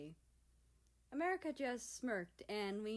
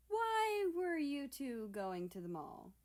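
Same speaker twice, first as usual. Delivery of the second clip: high, slow questioning voice; lightly voiced America; suspicious America; 7 semitones higher, 4 dB louder than usual. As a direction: press them with a raised, slow question.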